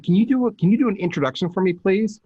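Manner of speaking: high, quick hopeful ask; high rushed polite plea; eager request. Retold or imitated. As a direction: sound keen asking the favour. Asking for an introduction.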